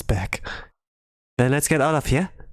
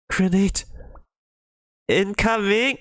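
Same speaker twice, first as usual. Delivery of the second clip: high, urgent shout; warning shout; 8 semitones higher, slower than usual. Acted. Student warning the others of a grenade, high shout. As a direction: yell the warning.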